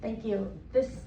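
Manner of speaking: normal talking voice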